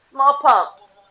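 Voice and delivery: loud, laughing call of the word; playful shout. Real, not acted. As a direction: yell it for fun.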